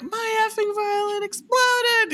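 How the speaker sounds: hammed-up angry customer with a cry in the voice. Customer in the skit yells at the luthier, hammed-up fury. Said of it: "Can I swear?".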